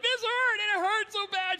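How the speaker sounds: high, loud mock wail; hammed-up bawling of a hurt child; crying child. Daughter wailing after her shot, imitated crying. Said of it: "She was crying".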